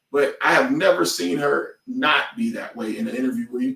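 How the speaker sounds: ordinary delivery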